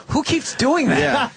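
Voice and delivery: slowly drawn-out bewildered question; puzzled crowd. Retold or imitated. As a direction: wonder aloud who's shushing. Confused people in a crowd.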